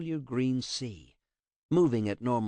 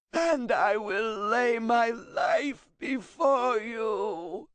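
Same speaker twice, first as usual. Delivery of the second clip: high, slow, loud wailing cry; sobbing; 13 semitones higher, 3 dB louder, much slower than usual. A narrator's reading. Translator reading his own death vow, high sobbing wail. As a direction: read it out through sobs.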